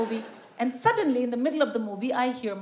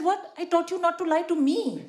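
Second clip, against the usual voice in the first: high-pitched, incredulous retort; acted indignation; 7 semitones higher than usual. Mother retorting to daughter about lying, acted high indignation. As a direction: play an exasperated parent, voice jumping up.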